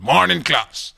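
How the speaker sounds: loud, high call to a whole class; inspector greeting a class. Acted. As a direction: boom out a high greeting to pupils.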